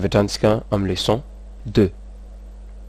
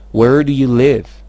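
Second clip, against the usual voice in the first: "Where do you live?" slow and loud, falling at the end; question intonation; 3 semitones higher, 5 dB louder, slower than usual. Shown as a demonstration. Teacher models intonation of "Where do you live?", slow with falling end. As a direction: stress "Where", then let "live" fall. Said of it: "Azadiny foana ny intonation".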